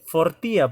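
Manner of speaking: fortía said loud and set apart, stress on tí; Latin word fortía stressed. Shown as a demonstration. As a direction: announce fortía as the Latin example. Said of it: "por ejemplo". (fortía dicha con fuerza, acento en tí)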